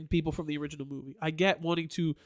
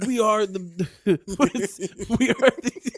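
breaking up mid-line into giggles; can't finish the quote for laughing; giggling; 2 semitones higher, 3 dB louder than usual. Real, not acted. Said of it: "You're giggling like a little kid".

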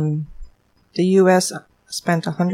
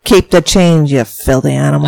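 loud tough-guy movie line; movie gangster quote; 11 dB louder than usual. Acted. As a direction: bark the old movie line.

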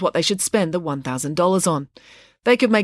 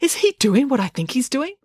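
high, astonished question; acted amazement, pitched up; exclaiming; 7 semitones higher than usual. A narrator's reading. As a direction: ask it in scandalised disbelief. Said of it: "exclaimed Loretta".

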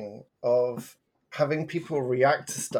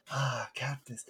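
hushed awe; 11 dB quieter than usual. Retold or imitated. Awed reaction to a name.

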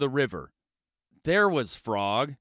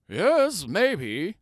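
higher sly turtle; 4 semitones higher than usual. Acted. Turtle teasing Toad, sly turtle voice. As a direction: tease it as the turtle.

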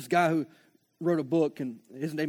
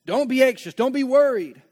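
frustrated protest; 4 semitones higher, 6 dB louder than usual. Pretend. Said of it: "It says".

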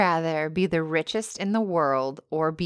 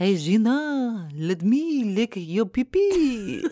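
slow, drawn-out French seducer; mock French accent; 4 semitones higher, slower than usual. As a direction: ham up a high, silly French accent. Hammed French accent after French talk.

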